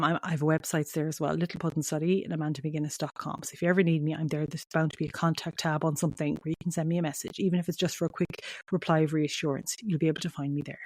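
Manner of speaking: ordinary talk, nothing marked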